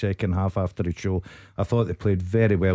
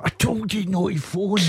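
mocking a parent; 9 semitones higher than usual. Mock family member scolding.

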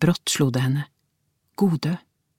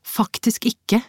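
short, higher-pitched retort; a touch curt; curt reply; 7 semitones higher, slower than usual. A narrator's reading. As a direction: answer briefly, pitched up. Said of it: "svarte hun kort".

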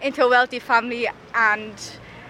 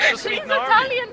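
laughing; 13 semitones higher than usual. Real, not acted. Laughing at presenter's bad accent.

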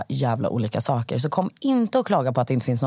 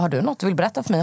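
rushed question; 4 semitones higher, much faster than usual. Retold or imitated. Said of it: "Jag bara".

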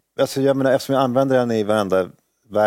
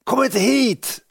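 much higher shrill-free imitated scream of mother; mock scream; much slower than usual. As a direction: screech it like the furious mother. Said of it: "mamma skriker så här". (mamma skriker åt sönerna: härmat gällt skrik)

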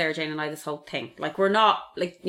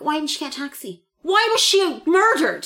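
indignant mock quote; 9 semitones higher, 3 dB louder than usual. Mocking outraged public questions.

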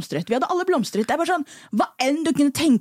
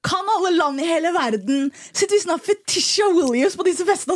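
usual speaking voice

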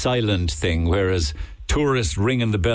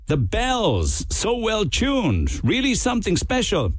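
high, slow, lightly American tourist; American accent; 12 semitones higher, slower than usual. Retold or imitated. Tourist repeats his praise of the bells in a high American accent. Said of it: "his American accent".

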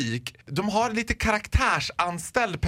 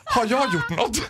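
higher, timid-guilty question; mock fright; 4 semitones higher than usual. Girl at the till hearing the voice, mock fright. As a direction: ask it as if caught out.

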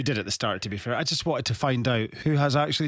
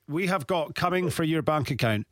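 normal talking voice

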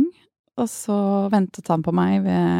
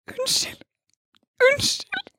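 very high, breathy tearful unnskyld; sobbing apology; slower than usual. Retold or imitated. Apologizing to old friend on plane, sobbing. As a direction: plead sorry through tears. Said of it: "Og jeg bare".

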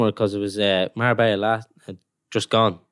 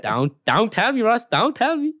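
much higher, slow pleading mock wife; imitating the missus; slower than usual. Footballer mimics his missus dreading the call-up, high slow pleading. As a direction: whine it high as his partner dreading news.

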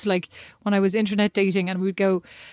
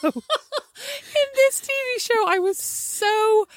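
high, giggly and drawn out; slow, high-pitched giggling delivery; laughing while talking; 11 semitones higher, slower than usual. Real, not acted. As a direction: struggle to talk through real laughter. Speaker laughing through the story.

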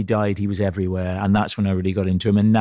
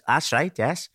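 higher Welsh lilt; Welsh piano teacher; 6 semitones higher than usual. Retold or imitated. Welsh teacher answering his new pupil, higher Welsh lilt. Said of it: "Dud is the Welsh piano teacher".

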